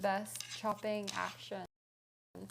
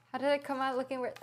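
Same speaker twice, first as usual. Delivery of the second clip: ordinary talk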